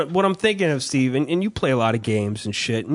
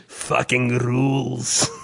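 slow, drawn-out Russian verdict; Russian guy savouring each word; Russian accent; 2 semitones lower, much slower than usual. Retold or imitated. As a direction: repeat the Russian guy's approval, stretching it. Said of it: "in his Russian accent".

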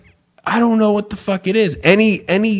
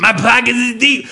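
lightly voiced jazzy old man, a bit higher; mock senile grandpa jive; 4 semitones higher than usual. Grandpa blurting his catchphrase at family, jazzy. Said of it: "Grandpa's talking jazzy again".

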